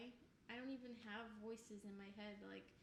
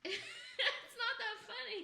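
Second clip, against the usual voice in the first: high, drawn-out protest through giggles; helplessly laughing, voice pitched way up; 10 semitones higher, 8 dB louder, much slower than usual. Real, not acted. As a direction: protest loudly while still laughing. Protesting while still laughing.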